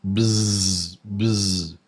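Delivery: buzzing voiced zzz; bee buzz. Shown as a demonstration. Teacher showing learners the bee sound, buzzing. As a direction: buzz like a bee so the throat shakes. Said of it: "or is it a bee?".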